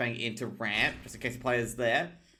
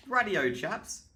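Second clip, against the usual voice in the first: mock English accent; 8 semitones higher, slower than usual. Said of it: "I don't need an English accent".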